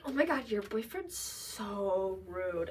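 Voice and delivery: put-on slow, drawn-out girly dismay; mock offended. Imitating girls offended by her boyfriend, slow mock dismay. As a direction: stretch it out like a scandalised friend.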